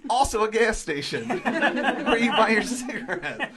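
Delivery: breaking into laughs while explaining; laughing through it. Retold or imitated. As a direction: laugh your way through the pitch. Laughing while retelling his explanation.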